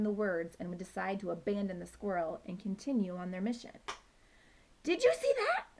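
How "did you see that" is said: high, loud alarmed scream. Acted. Poo Poo screaming at the squirrel's twitch. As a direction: shriek it in outrage. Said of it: "Poo Poo screamed".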